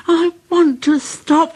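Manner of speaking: high pleading engine voice; high weary whine; tired Percy. A narrator's reading. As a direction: plead to stop.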